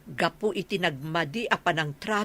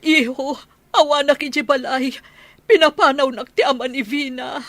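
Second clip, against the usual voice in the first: higher and louder, upset telling; distressed plea; 7 semitones higher, 6 dB louder than usual. Acted. Mother telling her son she was thrown out, raised distressed voice. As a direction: blurt out the bad news in distress.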